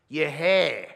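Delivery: put-on loud gruff older bloke, your hair; mimicking an older guy questioning the hair. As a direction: bark it like a puzzled older man. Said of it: "they'll say something like this".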